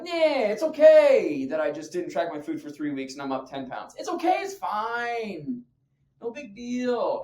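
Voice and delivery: mock shrugging it off, stretched words; dismissive excuse-making. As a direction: drag out a careless self-excuse. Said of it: "it's not that big of a deal and whatever".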